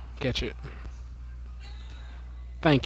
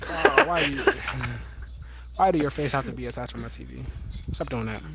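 normal talking voice

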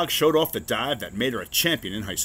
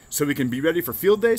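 eager, fast and loud; excited; 6 semitones higher, 5 dB louder, faster than usual. A narrator's reading. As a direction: blurt it out eagerly. Frog asks eagerly.

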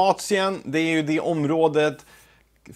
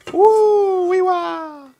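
excited whoop; 5 dB louder, much slower than usual. Real, not acted. Steam bursts from lifted lid.

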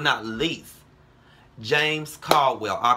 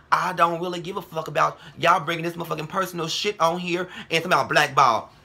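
normal talking voice

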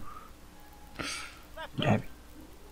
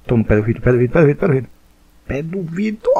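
ordinary delivery

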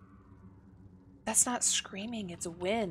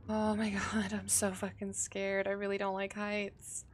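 ordinary talk, nothing marked

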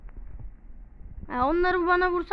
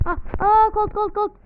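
panicked yelp; 7 semitones higher, 6 dB louder than usual. Real, not acted. Panics as enemy Colt appears.

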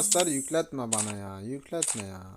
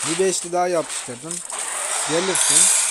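louder, eager coaxing; excited; 3 semitones higher, 5 dB louder than usual. Real, not acted. Gamer coaxing the next spin, excited. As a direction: call the prize to you.

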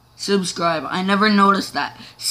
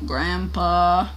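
ordinary talk

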